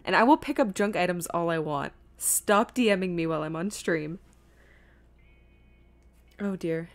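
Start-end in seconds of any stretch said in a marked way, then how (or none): none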